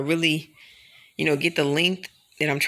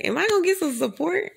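pitched way up, pleading for support; high pleading question; 3 dB louder than usual. Retold or imitated. Complaining about the nail community, high pleading question. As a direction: ask it high and hurt, like nobody cares.

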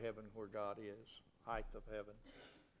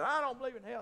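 mock defiance; 11 semitones higher, 7 dB louder, faster than usual. Acting a scoffer's defiant reply.

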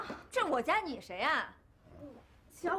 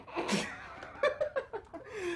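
laughing; 6 semitones higher, 4 dB quieter than usual. Acted. Mocked as grandma, she laughs.

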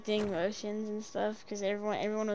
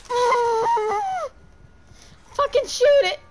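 shrieking, much higher and louder; frantic scream; 14 semitones higher, 11 dB louder, much slower than usual. Real, not acted. Player screaming at her partner to shoot the creature, shrieking.

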